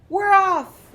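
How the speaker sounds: very loud, high cheer; group shout. A narrator's reading. The crew cheers setting sail, very loud shout. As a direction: yell it out with the whole crew. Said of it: "we all shouted".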